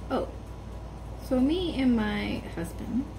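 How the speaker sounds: normal talking voice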